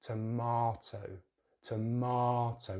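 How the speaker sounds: "tomato" as tuh-MAH-toh; "tomato" with long open ah; British pronunciation. Shown as a demonstration. Teacher returns to British English, "tomato" with MAH. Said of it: "So British English".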